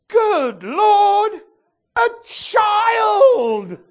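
high loud cry of delight; overjoyed cry. Acted. Movie man sees a little girl, high joyful shout. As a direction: cry out in amazed joy. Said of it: "and he went".

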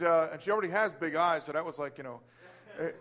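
normal talking voice

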